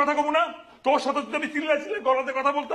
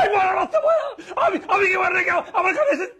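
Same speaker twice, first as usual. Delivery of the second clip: angry shout; 5 semitones higher than usual. Acted. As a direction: yell in a rage. Heated marital quarrel. (রাগে চেঁচিয়ে বলা)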